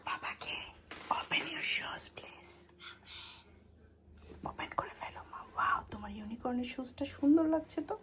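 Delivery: ফিসফিস করে; whisper. Real, not acted. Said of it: "আমরা আস্তে আস্তে কথা বলছি, কারণ তাবিদ ঘুমাচ্ছে".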